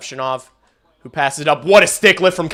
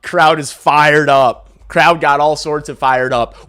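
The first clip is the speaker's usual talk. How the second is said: usual speaking voice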